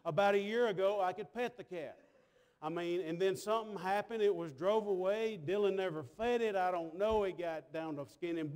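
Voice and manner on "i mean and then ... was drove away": usual speaking voice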